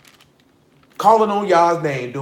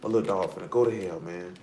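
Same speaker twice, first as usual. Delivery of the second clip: much softer, rueful; quiet lament; 8 semitones lower, 9 dB quieter than usual. Retold or imitated. Telling a friend about his homeboy's death, quietly rueful. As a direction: mutter it sadly about the friend.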